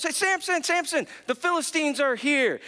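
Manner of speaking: mock yell to wake him, pitched high. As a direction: call out like a hammed-up alarm. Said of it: "then she yells".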